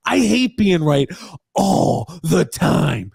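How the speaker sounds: high droning aggrieved whine, very slow; Grump impression. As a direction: stretch "all the time" into a long whine. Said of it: "I'm trying to do my Grump impression".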